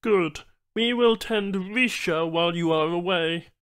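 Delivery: high, odd-timbred alien voice; alien Banu trader. Acted.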